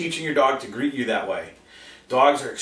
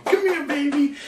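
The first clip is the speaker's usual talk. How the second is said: put-on high baby-talk call; 13 semitones higher than usual. Owner calling puppy in high baby talk. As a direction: coo the puppy over. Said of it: "what do we do?".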